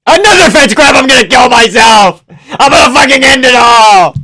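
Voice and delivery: loud, high outburst of exasperation; exasperated yelling. Real, not acted. Fan raging at the fight over a fence grab, exasperated shout. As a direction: yell it at the end of your patience.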